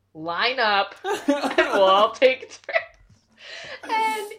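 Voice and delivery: can't hold it together; laughing through it. Retold or imitated. Congo Line joking to the gems, laughing loudly. Congo Line's joke to everyone.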